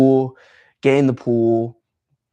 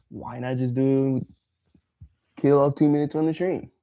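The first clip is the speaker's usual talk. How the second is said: usual speaking voice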